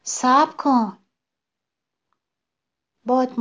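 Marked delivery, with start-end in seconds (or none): 0.0-1.0 mild raised-voice call to wait; understated shout, higher and louder; last-strength cry. A narrator's reading. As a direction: call out with what strength is left. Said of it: "درخت با آخرین رمق فریاد کشید".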